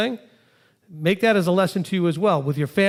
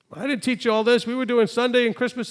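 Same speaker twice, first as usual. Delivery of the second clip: higher, put-on voice of a defensive mother; lightly voiced mother, defensive; mock mother; 7 semitones higher than usual. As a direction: play the mother brushing it off.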